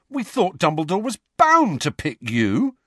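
high-pitched indignation; indignant. A narrator's reading. George protesting to Harry about the prefect choice, indignant. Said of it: "said George indignantly".

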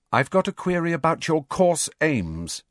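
put-on a bright young student pressing; girl's voice. Hermione challenging teacher.

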